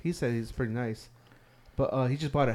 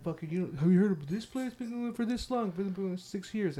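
mock newcomer; 6 semitones higher, 4 dB quieter than usual. Mocking Riverside newcomers.

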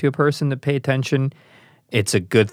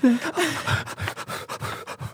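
mock gasp; 6 dB quieter than usual. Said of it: "And then he said to me that".

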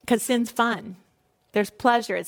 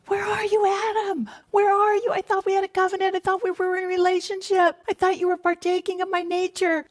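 high, grieving cry, quicker on the pleading lines; God crying out; 7 semitones higher, faster than usual. Acted. God crying out to Adam, high grieving plea. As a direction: plead like a heartbroken father. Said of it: "God called out, God cried out".

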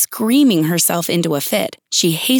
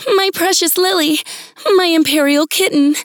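frantic queen; 8 semitones higher than usual. Acted.

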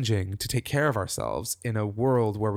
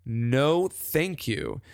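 firm refusal; 5 semitones higher, much slower than usual. Retold or imitated. Guest shutting down her lazy inner voice, slow and defiant. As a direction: stretch out the refusal, pushing back. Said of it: "hear that voice and go".

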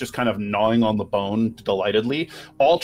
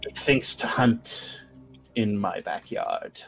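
menacing witch; 5 dB quieter than usual. Acted. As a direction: warn them of the hunter in the dark. Baba Yaga describes fiend.

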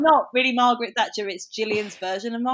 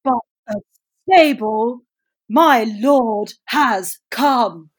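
a lower, rougher, loud and slow Thatcher; Thatcher impression, lower; 9 dB louder, much slower than usual. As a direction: lower and rougher, keep it grand. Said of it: "lower, rougher".

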